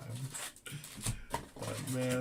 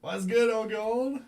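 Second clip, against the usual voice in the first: drawn-out hype greeting; 9 semitones higher, 7 dB louder, slower than usual. Real, not acted. Host greets a chat viewer.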